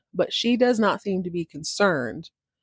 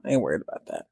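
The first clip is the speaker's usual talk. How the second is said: deep, fast, careless brush-off; a dismissive, offhand grumble; dismissive low grumble; 13 semitones lower, 7 dB quieter, faster than usual. Retold or imitated. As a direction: toss it off low and quick. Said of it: "She goes".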